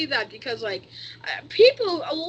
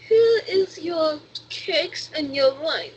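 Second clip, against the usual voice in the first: slow, botched German accent drifting Russian; Russian-sounding German accent; 2 semitones higher, slower than usual. Shown as a demonstration. Kid playing a German host offering treats, botched Russian-sounding German accent. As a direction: attempt German slowly, let it turn Russian. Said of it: "that's still Russian".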